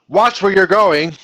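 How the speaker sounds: loud, high, drawn-out warning; strong command. Shown as a demonstration. Teacher showing an imperative with exclamation point, strong loud command. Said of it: "that would be a strong command".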